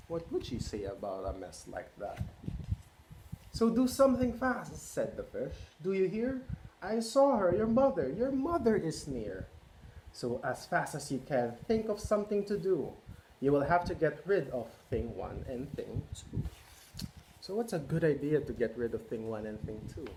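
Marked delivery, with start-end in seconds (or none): 3.5-9.5 1 urgent. Acted. Fish urges kids, mother coming. 10.1-16.4 lively picture-book read-aloud; reading to children. A narrator's reading. Parent reading Cat in the Hat to children, lively.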